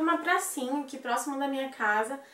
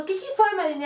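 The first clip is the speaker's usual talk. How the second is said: higher, louder mock alarmed mother; anxious mother; 8 semitones higher, 4 dB louder, faster than usual. Mother asking her other daughter what happened, alarmed mock voice. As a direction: demand an answer, alarmed.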